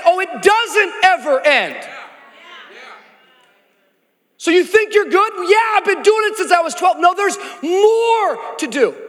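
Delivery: lively dramatized exchange, high and loud; acted dialogue. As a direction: play both voices of the scene, animated. Preacher acts out Jesus and rich man.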